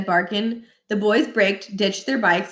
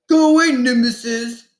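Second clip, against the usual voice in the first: put-on rude teen; 4 semitones higher, 6 dB louder than usual. A narrator's reading. Josh voiced rudely at door.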